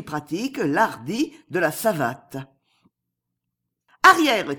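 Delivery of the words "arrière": un « Arrière ! » aboyé, fort et aigu; cri furieux. Acted. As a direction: repousser l'homme d'un cri. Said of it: "cria-t-elle". (Europe repousse l'agent d'un cri furieux)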